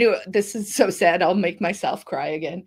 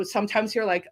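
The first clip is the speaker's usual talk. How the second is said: ordinary delivery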